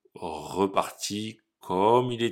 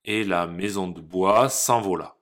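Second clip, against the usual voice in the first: ordinary delivery, nothing marked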